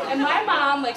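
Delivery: ordinary talk, nothing marked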